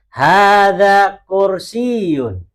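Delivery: slow, loud, drawn-out Arabic, every syllable of "Hada masjidun" stretched; slow model reading. Shown as a demonstration. Teacher modelling Arabic for the class, slow loud recitation. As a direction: chant it slowly for the class to repeat. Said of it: "yang lain ikutin".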